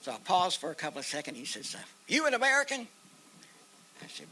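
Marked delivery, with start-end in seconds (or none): 2.1-2.9 high, loud suspicious question; retold challenge. Base soldier to the man in the paddy, retold challenge. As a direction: call it out high, like a wary sentry.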